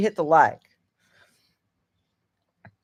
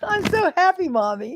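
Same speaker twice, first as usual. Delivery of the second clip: high-pitched voice for a delighted pet; happy dog; 12 semitones higher than usual. Acted. Dog tells its mommy how it feels, happy high voice. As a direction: speak for the dog, high and giddy. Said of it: "He's like".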